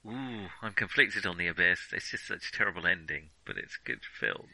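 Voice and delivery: usual speaking voice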